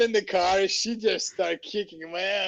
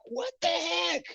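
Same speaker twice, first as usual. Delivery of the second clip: high, puzzled mock outburst; an incredulous squeak at everyone being nice; mock baffled; 12 semitones higher, 5 dB quieter than usual. While door-knocking, puzzled that everybody is nice, squeaks in mock surprise. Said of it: "I'm like".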